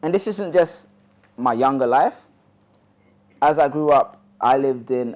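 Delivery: normal talking voice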